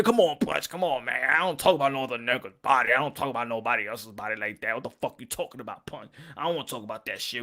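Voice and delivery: lightly voiced annoyed guy; complaining critics. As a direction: brush off the topic like an annoyed fan. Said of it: "there's gonna be people out there".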